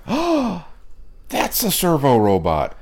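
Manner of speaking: suddenly recognizing something, spelled out slowly; surprised recognition. Retold or imitated. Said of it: "sitting up and going".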